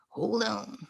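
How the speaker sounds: hushed whispered hold on. Real, not acted. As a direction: drop to a breathless whisper. Pausing the call setup.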